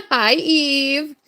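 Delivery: usual speaking voice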